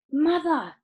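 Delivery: high, loud excited call; child calling out eagerly; Charlie crying out. A narrator's reading. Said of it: "cried Charlie".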